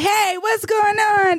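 high, slow yelled call; slow, high mock neighbor yell. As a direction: shout it out the window at night. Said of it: "all the neighbors are like".

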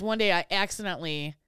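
ordinary talk, nothing marked

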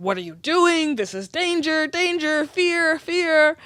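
high, rising panic, mock alarm; alarmed inner voice. Body's alarm voiced as inner quote.

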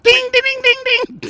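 high imitated ricochet pings; ping it out like pellets bouncing; ricochet dings.

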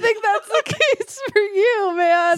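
high laughing voice, slowed. Real, not acted. Reacting to the grim dream story, laughing. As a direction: laugh through the words.

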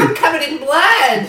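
very high, loud shriek; hammed-up horror shriek; mock shriek. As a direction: squeal it in horror. Victim shrieks.

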